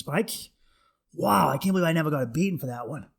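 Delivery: ordinary talk, nothing marked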